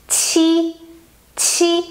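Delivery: "qi" with a strong puff of air; aspirated qi. Shown as a demonstration. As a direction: push lots of air on "qi". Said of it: "you have to send the air".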